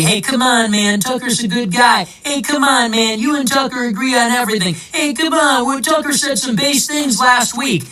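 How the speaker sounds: imitating naysayers. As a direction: imitate people pleading someone's case. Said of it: "People are always telling me".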